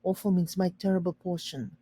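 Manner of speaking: usual speaking voice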